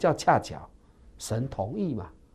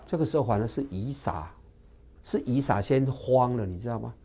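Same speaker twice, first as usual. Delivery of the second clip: ordinary talk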